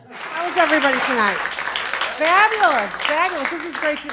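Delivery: loud, high, gushing greeting. Real, not acted. Comic greeting the audience, gushing and loud. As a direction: sell it big to the crowd.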